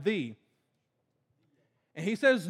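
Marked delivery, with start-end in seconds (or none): 0.0-0.3 "the" pronounced as "thee"; stress on THE. Shown as a demonstration. Preacher pointing out the emphasized word, "the" as "thee". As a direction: give "the" its emphatic "thee" form, low and soft. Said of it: "there's an emphasis on the word".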